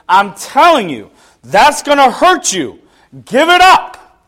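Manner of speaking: loud, high, climbing to a shout; urgent warning. Retold or imitated. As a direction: imitate God warning with mounting force. God disciplining, warning urgently.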